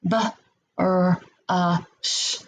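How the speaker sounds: B, R, A, sh each said as a separate sound; sound-by-sound segmenting. Shown as a demonstration. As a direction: break brush into separate sounds. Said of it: "What are the sounds that make up the word brush?".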